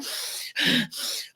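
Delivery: slow audible breath out; calming sigh out; long exhale. Shown as a demonstration. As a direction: demo a long relaxing exhale. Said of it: "Full panic attack, like".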